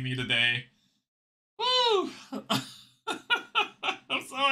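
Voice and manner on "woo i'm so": high squealing whoop; joyful whoop. Real, not acted. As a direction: squeal it with glee. Celebrating the win.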